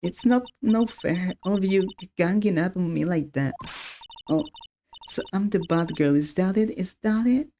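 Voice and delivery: put-on high, aggrieved pouty girl voice; April May. Cornered witness protests to the court, high whiny indignation. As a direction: play the wronged girl, pitched high and rising.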